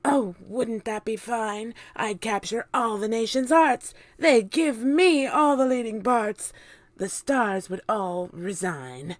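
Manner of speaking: a grand diva daydreaming aloud; Aunt Sponge dreaming. A narrator's reading. Aunt Sponge imagining Hollywood fame, grandly. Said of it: "Aunt Sponge declared".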